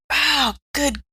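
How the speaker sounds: ordinary delivery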